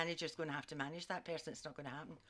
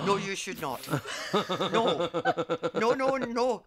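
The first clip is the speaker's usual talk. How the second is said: louder, laughing refusal; hammed-up firm no; mock-alarmed no; 5 semitones higher, 10 dB louder than usual. Telling the caller not to sleep with his mother-in-law, loudly. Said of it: "I said".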